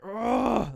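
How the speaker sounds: mock furious ugh; angry grunt. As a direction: explode in an angry groan. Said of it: "she actually gets angry".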